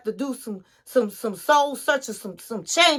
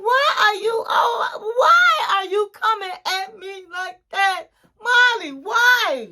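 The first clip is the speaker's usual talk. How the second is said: hurt husband; 8 semitones higher than usual. Retold or imitated. Husband protests, hurt and defensive.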